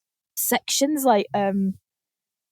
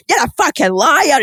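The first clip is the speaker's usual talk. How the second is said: louder mock accusing yell; mock angry shout; 4 semitones higher, 6 dB louder than usual. Contestants accusing each other at the round table, mock shouting. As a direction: hurl the accusation in a hammed-up row. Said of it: "different accents shouting angrily".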